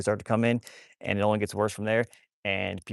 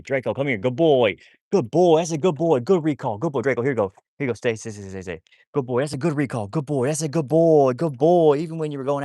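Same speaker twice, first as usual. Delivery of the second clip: bright, louder praise for a good dog; 4 semitones higher, 7 dB louder than usual. Real, not acted. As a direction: gush over the dog for coming back. Praising the dog's recall.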